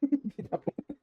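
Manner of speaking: high stifled giggle; laughing. Real, not acted. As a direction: burst into a squeaky snicker. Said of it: "il faut que je rigole".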